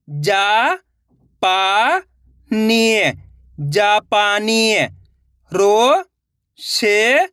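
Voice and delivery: each syllable drawn out on a rising pitch, then the whole word; syllable drill. Shown as a demonstration. Teacher sounding out Hindi syllables for young readers, drawn out. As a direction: sound out syllables slowly for beginners.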